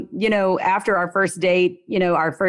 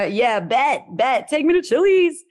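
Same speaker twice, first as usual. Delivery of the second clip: excited; 7 semitones higher than usual. Retold or imitated. Eager yes to Chili's invite.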